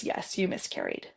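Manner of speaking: whispered, breathless answer; hushed inner voice. Retold or imitated. Inner voice answering her question in a whisper. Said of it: "the response back was".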